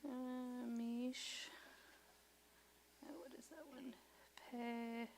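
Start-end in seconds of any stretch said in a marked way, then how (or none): 3.0-3.9 soft unvoiced whisper. Real, not acted. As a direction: whisper the question to yourself. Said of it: "whispering to herself".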